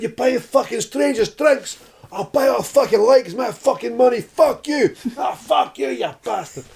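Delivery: imitation of a raging couple, high and loud; screaming row. Missus and Scottish guy rowing over bought drinks, screaming. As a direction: shriek it like a pub domestic. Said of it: "they're having a full-on bang of the two of them".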